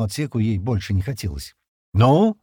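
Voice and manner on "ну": loud, high taunting prompt; jeering. A narrator's reading. Стэн goading Джемма to decide, jeering. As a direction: goad with one raised word. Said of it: "с издёвкой в голосе".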